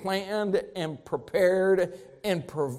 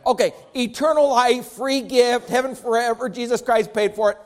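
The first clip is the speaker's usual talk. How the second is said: ordinary talk, nothing marked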